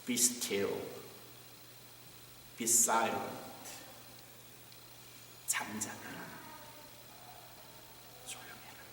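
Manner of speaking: low, soft, hushing the sea; a quiet voice stilling the storm; hushed calming command. Acted. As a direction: speak it down into stillness, almost a whisper. Jesus calms the storm.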